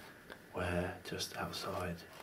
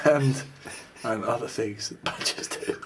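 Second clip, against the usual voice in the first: loud; 6 semitones higher, 8 dB louder than usual. Pretend. Badger character carrying on loudly.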